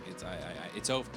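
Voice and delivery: normal talking voice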